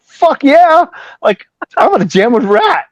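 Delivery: high, loud, gleeful yes; retold excitement. Bassist invited to jam with Rat, thrilled. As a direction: burst out like a thrilled fan.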